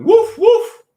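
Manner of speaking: high, loud cartoon dog woof; dog barking. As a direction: bark it out like a little dog. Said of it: "the dog is going".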